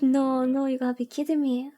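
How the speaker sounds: normal talking voice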